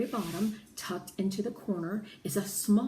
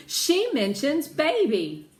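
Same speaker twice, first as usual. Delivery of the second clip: higher, louder and slowish; thrilled child reading aloud; excited announcement; 7 semitones higher, 8 dB louder, slower than usual. A narrator's reading. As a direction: share the find with delight. Excited discovery.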